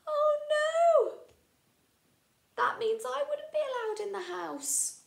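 high, loud pretend shock; mock dismay. Teacher pretending to be shocked by George's rule, high. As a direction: gasp it out for the children.

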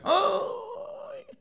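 sharp high wail of anguish. Acted. Preacher voicing a soul's cry from hell, high wail. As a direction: cry out in pain.

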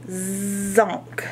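ordinary talk, nothing marked

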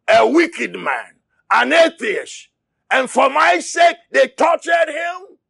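shouted sermon, driving hard; impassioned preacher; fiery preaching. Acted. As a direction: preach it at full force to the church. Preaching at full force.

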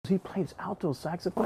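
quietly astonished, voicing the listener; retold surprise. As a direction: repeat it softly in mild amazement. Retold surprised echo of that fact.

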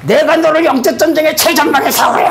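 "너를" punched high in a booming prophetic call; ecstatic prophetic proclamation. Retold or imitated. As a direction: shout it out as if seized by the spirit. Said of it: "제가 이래요".

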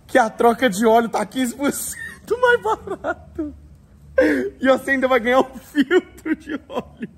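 reading it through laughter; can't stop laughing mid-sentence. Real, not acted. Laughing while reading sponsor offer. (rindo enquanto fala)

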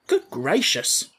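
slow, drawn-out astonishment; a mild old-fashioned gasp of shock; a gatekeeper taken aback; surprised exclamation. Acted. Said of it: "returned the surprised guardian".

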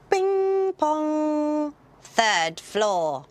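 high sung chime, then announcer voice; mimicked automated lift voice; lift announcement. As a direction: imitate a lift's bing-pong and floor call.

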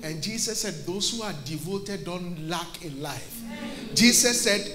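low, soft storytelling from the pulpit; hushed preaching. Real, not acted. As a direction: drop right down in volume and pitch to draw the congregation in.

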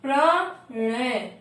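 प्रणय drawn out very slowly, with a clear retroflex ण; teacher slowly sounding out प्रणय; slow demo. Shown as a demonstration. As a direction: stretch प्रणय syllable by syllable. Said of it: "क्या हो गया".

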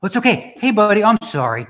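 high, loud apologetic pet-talk; sweet high voice for a pet; friendly dog voice. Real, not acted. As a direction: soothe the dog after yelling. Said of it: "I gotta do the good friendly voice".

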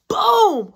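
loud, high "boom" of a hit; sudden loud impact noise; imitated blow. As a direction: punch out the sound of a blow. Imitating blows in retold beating.

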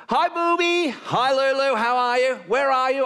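lightly voiced wife, high chirpy greeting; wife on the phone. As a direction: act out a cheery phone call. Phone call with wife, acted out.

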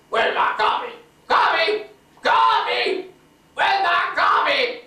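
high, loud mock yell for coffee; imitating wife's shout. Husband mimicking his wife calling for her coffee, loud mock shout. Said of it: "When Honey Bunch says".

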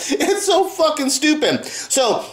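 ordinary talk

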